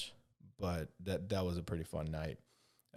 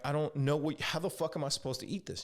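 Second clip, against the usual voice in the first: higher and louder, fed-up disbelief; exasperated outburst; 8 semitones higher, 4 dB louder than usual. Retold or imitated. Retelling the burrito fight with the waitress, exasperated higher and louder. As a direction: demand an answer in mock outrage.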